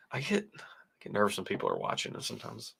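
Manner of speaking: whispered, hurried and sheepish; 긴장해서 작게 속삭이듯; embarrassed whisper. Real, not acted. Embarrassed about viewers watching.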